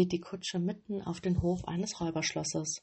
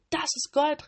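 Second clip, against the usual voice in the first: greedy robbers yelling even higher; louder, higher shout; 8 semitones higher, 5 dB louder than usual. A narrator's reading. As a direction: shout it again with more greed. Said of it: "riefen sie". (noch lauter, höher rufend)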